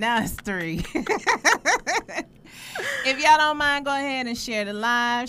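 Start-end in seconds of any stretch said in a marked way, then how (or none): 0.9-3.0 high burst of laughter; big laugh; loud laugh. Real, not acted. Host laughs at viewer count. 3.0-5.3 loud, upbeat call to viewers. Real, not acted. Host calling viewers to share.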